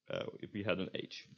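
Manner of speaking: usual speaking voice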